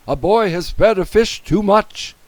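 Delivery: high, loud emergency report; urgent alarm. A narrator's reading. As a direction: sound the alarm over the radio. Policeman raises radio alarm.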